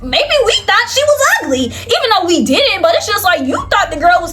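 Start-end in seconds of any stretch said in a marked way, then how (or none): 0.0-1.6 high, sassy mock comeback; sassy retort. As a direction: toss it back with attitude, pitched way up.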